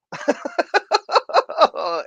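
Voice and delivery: high giggle burst; high laugh. Real, not acted. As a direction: laugh at yourself. Laughs at own joke.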